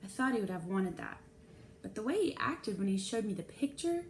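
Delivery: ordinary delivery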